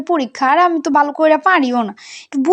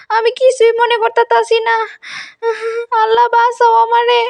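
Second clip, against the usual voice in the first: high, panicked pleading; 6 semitones higher than usual. Acted. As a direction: cry for help in terror. Girl panics, forgot prayers.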